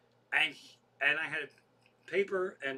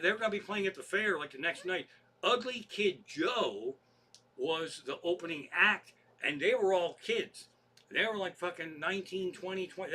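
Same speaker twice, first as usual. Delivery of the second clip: ordinary talk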